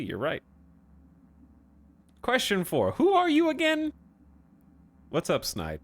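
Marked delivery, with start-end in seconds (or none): 2.2-3.9 put-on high, eager little kid voice; kindergartner voice. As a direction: ask like a confused small child. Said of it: "talking to me like a kindergartner".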